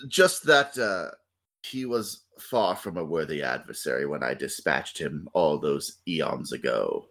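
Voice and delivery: low, slow gloating dark god; slow theatrical villain menace. Acted. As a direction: savor each word like an ancient evil. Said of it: "your evil villain monologue".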